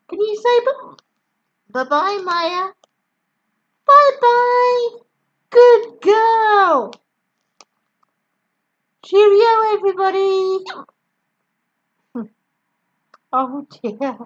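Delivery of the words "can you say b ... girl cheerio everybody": high, loud sing-song goodbye. Real, not acted. Saying bye to toddler.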